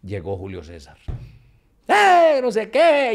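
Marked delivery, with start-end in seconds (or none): 1.9-3.1 excited greeting. Retold or imitated. Friend arrives cheering on stage.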